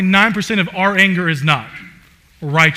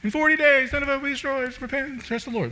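mock prophet's proclamation; 10 semitones higher, 5 dB quieter, faster than usual. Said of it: "He preaches, like, the shortest sermon ever".

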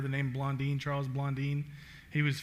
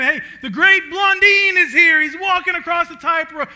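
much higher and louder, a crowd hailing a star; cheering crowd; 11 semitones higher, 14 dB louder than usual. Retold or imitated. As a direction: call it out like a fan at the falls.

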